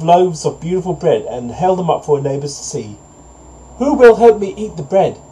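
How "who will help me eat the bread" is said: high-pitched storybook hen; little hen's high appeal for help; little hen calling. A narrator's reading. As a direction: call out to the neighbours in a high voice. Hen calls her neighbours for help.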